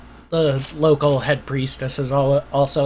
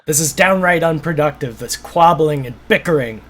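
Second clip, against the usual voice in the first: stern lord; 3 semitones higher, 3 dB louder than usual. Acted. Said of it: "the Lord steps in and he was like".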